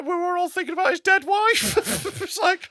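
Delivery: high laughing delivery. Real, not acted. Comic laughing about the widower bombing. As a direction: laugh while admitting it.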